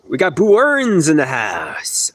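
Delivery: "boo-earns" drawn out slow as two syllables; pigeons pronounced boo-earns. Shown as a demonstration. Telling viewers the pigeons' name, slow "boo-earns". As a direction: stretch "boo-earns" like boo plus earns. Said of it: "they're saying boo-earns".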